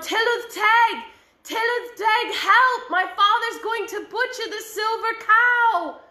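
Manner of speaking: high, loud cry for help; yelled for help. Acted. Boy calling to the lake spirits for help, a loud high yell. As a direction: call across the lake desperately. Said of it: "he yelled".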